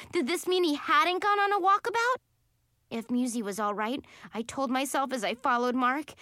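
usual speaking voice

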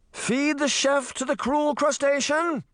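very high, ringing relay call; shouted order. A narrator's reading. Merman assistant relays the order, high-pitched shout. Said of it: "the merman assistant shouted".

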